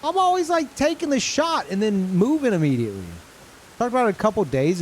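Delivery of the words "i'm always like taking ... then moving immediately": high incredulous complaint; outraged at not knowing; pitched-up disbelieving rant. Real, not acted. Learns he's been playing wrong.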